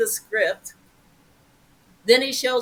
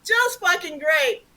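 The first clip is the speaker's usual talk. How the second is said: higher, mocking, slowed at end; sarcastic inner voice; 9 semitones higher, slower than usual. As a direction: roll the eyes at a stalker. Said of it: "my mind".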